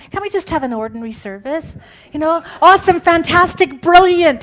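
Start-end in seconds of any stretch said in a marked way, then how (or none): 2.1-3.0 loud, high gushing praise words; mock hype. Preacher mocking hyped-up service praise, loud and gushing. 3.0-3.8 "fantastic" gushed loud and high, falling at the end; mock gushing. Preacher parodying hype, "fantastic" loud and high. Mocking hype about worship services. 3.8-4.4 loud, high gushing praise words; mock hype. Preacher mocking hyped-up service praise, loud and gushing.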